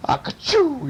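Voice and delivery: high, wordy mock sneeze; yelled sneeze. A narrator's reading. Cat lands on burglar's head, yelled sneeze. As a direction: let a hammed-up sneeze burst out. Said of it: "he yelled, he sneezed".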